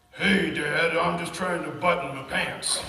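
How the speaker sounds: loud, mock boy voice, half laughing; a little girl calling out and putting on a boy's voice; kid faking a boy voice. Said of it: "trying to sound like a boy".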